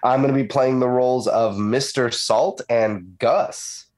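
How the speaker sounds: ordinary talk, nothing marked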